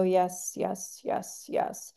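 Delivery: soft, trailing little yeses; nodding along. Acted. Listener faking understanding to a speaker, meekly agreeing. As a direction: say it quietly, just going along. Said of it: "don't sit there and go".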